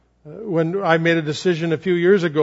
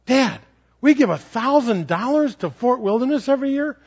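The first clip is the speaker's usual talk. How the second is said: son's question; 6 semitones higher than usual. Retold or imitated. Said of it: "and he says".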